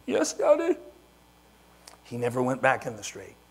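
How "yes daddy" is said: put-on high, loud little kid reply; meek child. Little Benny answering his daddy, high kid voice. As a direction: answer like an obedient small boy.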